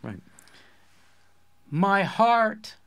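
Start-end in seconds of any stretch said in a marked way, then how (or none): 1.7-2.8 hammed-up poetic recitation, higher in pitch; mock-lyrical high school lit mag reading. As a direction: intone it like a grand poet. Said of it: "I'm gonna do my poet voice".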